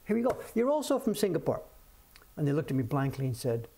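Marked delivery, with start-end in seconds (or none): 0.1-1.6 cocky guess. Retold or imitated. Owner guessing a young couple's country, high and cocky. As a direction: show off a sure guess. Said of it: "So I thought".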